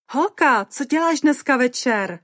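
high, loud excited squeal; Mimi. A narrator's reading. Excited friend calling Caroline about tonight, squealing. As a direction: squeal it down the phone. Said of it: "zapištěla do telefonu Mimi".

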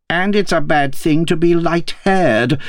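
high, insistent mock shriek; mild storybook scream. A narrator's reading. Roused Queen gets last word on the King, mildly screaming. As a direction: shriek it lightly, without real volume. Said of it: "screamed she".

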